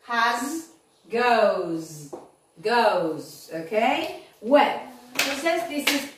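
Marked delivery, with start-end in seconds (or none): 1.1-3.5 goes said low, ending in a buzzing z; low, falling goes with a clear final z; z-sound ending. Shown as a demonstration. Teacher modelling the z sound of goes for the class. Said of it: "con la Z".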